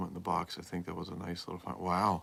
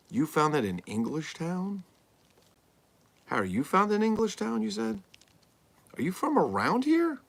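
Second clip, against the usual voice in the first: high, loud, amazed questions; leaping up in pitch, genuinely astonished; excited surprise; 13 semitones higher, 7 dB louder than usual. Real, not acted. Host amazed the caller lives nearby, excited high questions.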